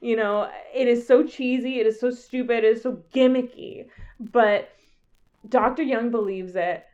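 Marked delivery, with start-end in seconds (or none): none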